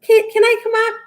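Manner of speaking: high pleading voice; mock begging Mike. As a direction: whine it like a man on his knees. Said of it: "get on his knees and beg".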